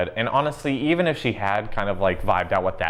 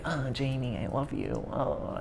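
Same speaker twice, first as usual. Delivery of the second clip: softer, swooning mock confession; mock lovestruck sigh; 4 semitones higher, 9 dB quieter, slower than usual. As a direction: play a lovesick girl, half-joking. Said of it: "she was like".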